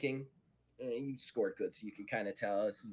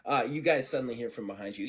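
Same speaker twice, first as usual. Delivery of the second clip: ordinary talk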